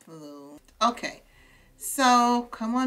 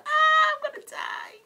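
excited squeal; 4 dB louder than usual. Real, not acted. Streamer squealing over the adorable date, very high. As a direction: squeal it much higher.

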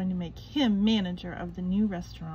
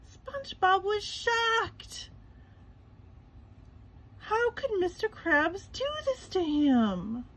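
shocked; 10 semitones higher, slower than usual. A narrator's reading. Narrator voices SpongeBob's shock.

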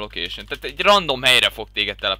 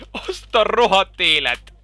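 slow, higher amazed cry; astonished exclamation; 6 semitones higher than usual. Real, not acted. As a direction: let the shock out slowly. Reaction to explosion.

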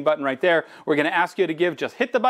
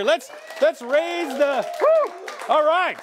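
cheering woo; 8 semitones higher, slower than usual. Real, not acted. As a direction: raise the roof with a whoop. Host cheers the room.

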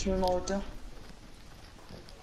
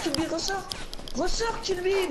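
urgent shout; 11 semitones higher than usual. Real, not acted. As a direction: yell at a teammate to get out. Urgent callout to teammate mid-fight.

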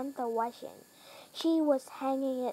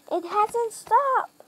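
high, slow, louder shout of stopped; 9 semitones higher, 5 dB louder, slower than usual. A narrator's reading. Harold chasing the runaway plane, alarmed shout. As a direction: cry out in alarm, drawn out. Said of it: "shouted Harold".

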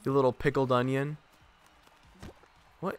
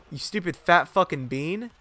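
ordinary delivery

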